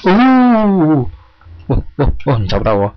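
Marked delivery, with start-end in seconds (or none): 0.0-1.1 sudden high shriek of 'oh'; jump-scare yelp, much higher; startled scream. Real, not acted. Monster suddenly appears.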